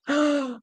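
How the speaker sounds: startled mock gasp; shocked gasp. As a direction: gasp as if just confronted by it. Imagining Amanda painting at the door.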